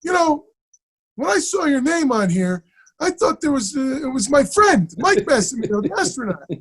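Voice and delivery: high-pitched imitation of a teacher; Chazz. Acting teacher puzzled by a student's name, imitated high. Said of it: "He goes".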